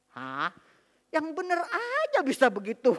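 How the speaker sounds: incredulous. Retold or imitated. Mother stunned by hell description.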